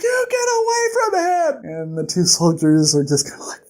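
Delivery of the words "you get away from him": very high, strained mock shout; high-pitched protective cry; frantic yell. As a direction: shriek a warning at attackers. Companion confronts soldiers attacking Kvothe.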